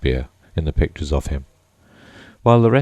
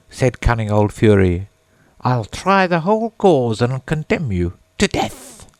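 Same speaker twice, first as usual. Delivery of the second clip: mouse reciting a tale; 7 semitones higher than usual. A narrator's reading. Mouse telling Alice its tale, lightly voiced and high. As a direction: recite it in a small put-on mouse voice.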